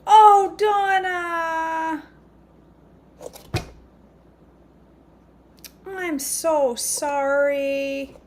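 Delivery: high, slow, stretched-out sorry; drawn-out sympathy. Real, not acted.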